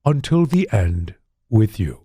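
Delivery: slow, stately captain voice, dropping low at the close; solemn vow in a put-on character voice; Picard. Shown as a demonstration. Father promising his son to stay, slow captain impression. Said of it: "See if I can do the impression".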